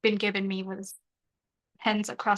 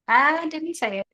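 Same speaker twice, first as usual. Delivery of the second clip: denial pushed higher and louder; 6 semitones higher, 4 dB louder than usual. Real, not acted. Streamer protesting innocence to her chat, louder and higher.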